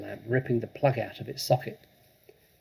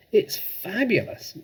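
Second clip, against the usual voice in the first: delighted; 9 semitones higher, 3 dB louder, slower than usual. Acted. Girl delighted by parrot.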